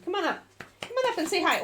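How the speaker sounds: high-pitched, softly coaxing pet talk; high, light invitation to a pet; coaxing a dog. Real, not acted.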